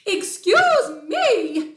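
loud, high, drawn-out outrage; owl's shriek. Acted. Tawny owl disturbed in its tree, indignant shriek. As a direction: shriek it slowly as an affronted owl. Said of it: "shrieked a tawny owl".

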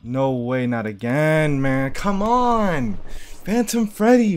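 loud exasperated gamer yell; frustrated yelling. Real, not acted. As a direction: shout at the screen in frustration. Rising frustrated outburst at game.

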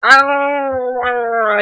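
high squeaky chipmunk voice. Acted. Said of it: "you guys like my chipmunk voice?".

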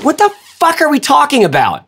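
a viewer scoffing at bag talk, high incredulous; baffled outburst. Pretend. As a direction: play the confused viewer who doesn't get it.

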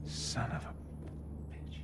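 whispered under his breath; muttered curse. Acted. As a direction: curse quietly to yourself. Discovers his password was guessed.

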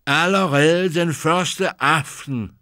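mother of the winds scolding the prince; angry. A narrator's reading. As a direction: scold him, each word weighed. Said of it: "Hun så vred ud".

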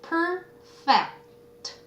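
"perfect" stretched out, split into per and fect; syllable by syllable. Shown as a demonstration. Teacher sounding out a word for students, "perfect" syllable by syllable. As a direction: draw out each syllable of "perfect". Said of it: "띄었으면 안 돼요".

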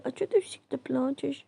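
normal talking voice

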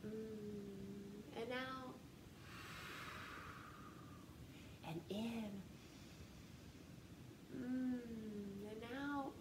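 soft slow audible breath in, then out; breathing demo. Shown as a demonstration. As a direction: breathe in through the nose with them. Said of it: "We're gonna breathe in".

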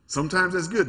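ordinary talk

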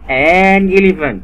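drawn-out article. Shown as a demonstration. Tutor stresses the article to a child slowly. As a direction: stretch "an elephant" slowly for the child. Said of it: "عشان في إي في الأول".

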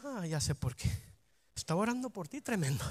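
very low, hushed musing; private realization. Acted. Praying believer realizing why to themselves, hushed knowing aside. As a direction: murmur it to yourself as it dawns on you.

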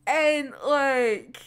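ordinary delivery

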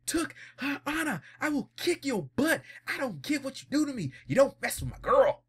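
high-pitched powered-up hero fury; Super Saiyan rage. Retold or imitated. Jonathan threatens Dio over his girl, high enraged hero voice. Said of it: "Going full on Super Saiyan".

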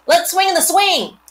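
put-on high, loud eager boy; excited kid.

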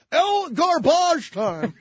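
high, slow sportscaster call; Marv Albert impression. Sportscaster calling junk time in a game, loud drawn-out call. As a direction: draw it out loudly like a TV play-by-play man. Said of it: "Marv Albert said".

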